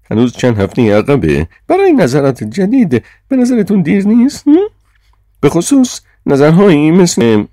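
high, loud reprimand; exasperated chairman's high voice; Sir John, irritated chairman. A narrator's reading.

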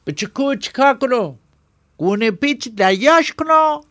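high, loud show-off henchman; squeaky loud mock-tough bluster; fake swagger. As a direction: puff up and pose for the boss. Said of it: "با ژستی الکی گفت".

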